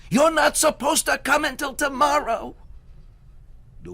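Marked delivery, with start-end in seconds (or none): 0.1-2.6 high, loud frightened shriek. Acted. Herschel shrieking at the wind, frightened. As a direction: keep shrieking in protest. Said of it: "Herschel shrieked".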